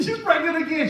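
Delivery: high-pitched hammed-up distraught mother; mock crying wail. Mother lamenting to the preacher about her daughter, mock crying wail. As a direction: wail it out in pretend tears. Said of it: "She's crying".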